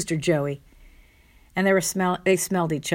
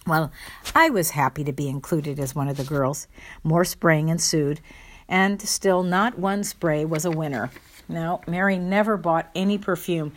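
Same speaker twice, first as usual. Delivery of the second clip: ordinary talk, nothing marked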